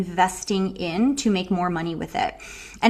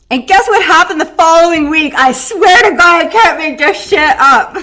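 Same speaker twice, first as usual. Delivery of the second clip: excited; 7 semitones higher, 12 dB louder than usual. Real, not acted. Excited reveal of the story's outcome.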